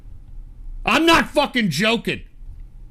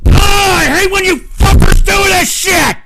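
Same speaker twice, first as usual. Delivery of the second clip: high, loud furious screaming at the chat; screaming rage, voice cracking high; furious yelling; 10 semitones higher, 11 dB louder than usual. Real, not acted. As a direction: blow up at the viewers.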